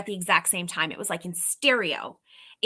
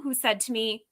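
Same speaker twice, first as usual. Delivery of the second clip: ordinary talk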